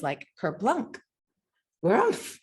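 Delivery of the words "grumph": high, startled bear grunt; a mother bear's startled grumph. A narrator's reading. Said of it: "she cried".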